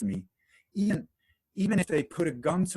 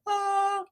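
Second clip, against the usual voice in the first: high squealing "Ah!" of thrill; excited gasp; 4 dB louder than usual. Retold or imitated. New car owner squeals with joy, high excited gasp. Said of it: "You drive the car".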